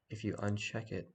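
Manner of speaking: ordinary talk, nothing marked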